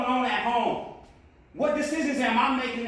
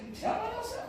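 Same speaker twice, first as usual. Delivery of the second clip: incredulous questioner; 6 dB quieter than usual. Retold or imitated. People questioning him.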